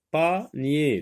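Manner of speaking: "Panier" as two separate beats, pa, nier; split into syllables. Shown as a demonstration. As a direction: pause inside "Panier" after pa. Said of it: "voici les mots séparés".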